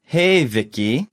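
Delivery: slow, careful greeting for learners; slowly. Shown as a demonstration. Greeting Vicky in a model dialogue, slowly. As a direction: draw out each word clearly. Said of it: "one more time slowly".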